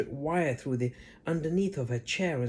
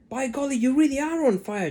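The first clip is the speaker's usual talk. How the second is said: George exclaiming; 12 semitones higher, 8 dB louder than usual. A narrator's reading. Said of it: "George said".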